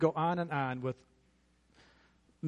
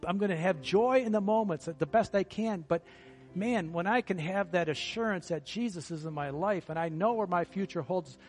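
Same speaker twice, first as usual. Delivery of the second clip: builds louder and higher with joy; rising joyful preaching; 4 semitones higher, 4 dB louder than usual. Acted. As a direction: lift the room toward heaven. Builds to joyful peak about heaven.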